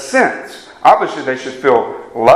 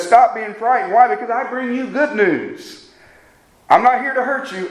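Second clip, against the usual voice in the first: quoting the angel; 7 semitones higher than usual. Retold or imitated. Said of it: "And this angel says".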